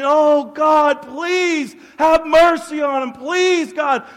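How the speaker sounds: loud, high impassioned plea to God; pleading. Retold or imitated. Man praying for someone he disliked, pleading. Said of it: "I'm pleading".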